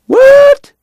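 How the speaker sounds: shocked, very high loud "What?"; startled outcry. Acted. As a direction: jolt out a stunned yelp. Bat startled by the sum.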